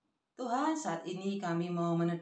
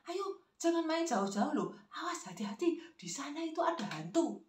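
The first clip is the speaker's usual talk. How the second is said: warning parent; 7 semitones higher than usual. Acted. Parent scares child with ghost.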